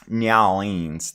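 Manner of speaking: slow, loud "Nyowlings" as NYAH-leens; mock game-character pronunciation of "Nyowlings"; Gabriel Knight. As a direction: stretch "Nyowlings" out comically. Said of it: "how Gabriel Knight pronounces".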